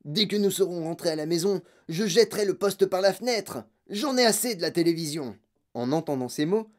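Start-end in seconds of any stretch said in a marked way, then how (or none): 0.0-5.4 fed-up father laying down the law; exasperated outburst; angry ranting. Acted. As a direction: rant with mounting irritation. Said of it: "hurla monsieur Tivy".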